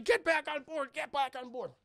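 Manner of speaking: high, loud mock alarm, easing on the repeat; urgent calling out. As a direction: order everyone aboard in acted panic. Han urgently orders retreat, retold.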